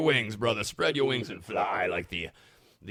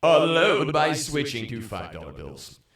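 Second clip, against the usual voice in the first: loud punchline; 6 semitones higher, 8 dB louder, slower than usual. Acted. Punchline delivery.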